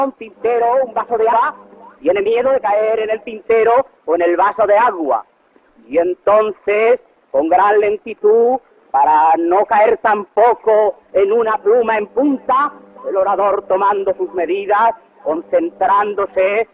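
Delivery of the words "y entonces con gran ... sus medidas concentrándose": drawn-out, deliberate delivery; slow down gradually, as if setting something down gently; slow careful landing. Acted. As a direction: stretch each phrase with great caution. Mimics orator's slow, careful landing.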